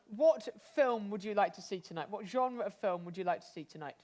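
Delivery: ordinary delivery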